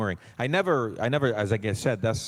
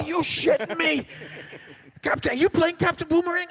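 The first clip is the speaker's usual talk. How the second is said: incredulous buddy; 4 dB louder than usual. Retold or imitated. Comic-fan buddy in disbelief.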